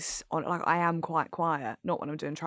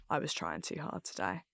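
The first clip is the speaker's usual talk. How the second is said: much lower and softer, crestfallen; deflated agreement; 11 semitones lower, 10 dB quieter than usual. Retold or imitated. Reading harsh tweets about herself, quietly deflated. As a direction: let it sink in, quietly defeated. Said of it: "I'll be like".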